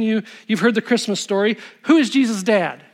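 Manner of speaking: ordinary delivery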